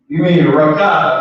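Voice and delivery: normal talking voice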